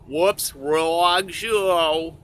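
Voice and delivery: slow, put-on New York accent.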